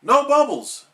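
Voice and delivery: high, loud burst of joy; excited. A narrator's reading. SpongeBob thrilled his cure works, excited. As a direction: blurt it out thrilled. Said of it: "said SpongeBob excitedly".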